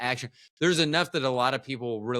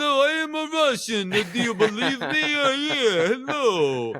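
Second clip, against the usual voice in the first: hammed-up Russian accent, much higher and louder; over-the-top high Russian accent voice; mock Russian accent; 12 semitones higher, 4 dB louder than usual. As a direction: play a cartoon Russian in a heavy accent. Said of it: "sound like I had a Russian accent".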